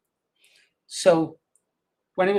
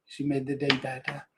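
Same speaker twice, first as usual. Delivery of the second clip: softer, a fond echo of the line; tender and soft; 2 semitones lower, 7 dB quieter than usual. Real, not acted. Grandfather echoing how his granddaughter made his day, softer and tender. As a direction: let it fall quietly, full of fondness.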